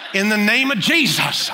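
high, loud preacher's prayer close; mock amen shout. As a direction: belt out the prayer's ending.

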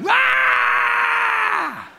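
piercing high shout held long; terrified scream. Retold or imitated. Passenger realizing nobody flies the plane, screaming high. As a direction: scream in pure panic. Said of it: "It's like".

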